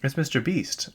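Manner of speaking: high-pitched imitation of a niece; light, high "oh" of recognition; niece's voice. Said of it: "my niece came in".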